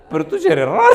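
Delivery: put-on very high laughing falsetto as the girl; mock girl voice. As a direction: squeak out her line while laughing. Voicing the girl's reply.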